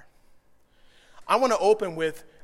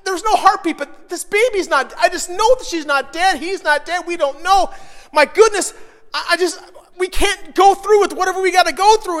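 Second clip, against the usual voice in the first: frantic, loud, high-pitched pleading; retold panic of an expectant mother, high and insistent; wife freaking out; 9 semitones higher, 7 dB louder than usual. Wife pleading with her husband after bad ultrasound news, frantic. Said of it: "she's freaking out".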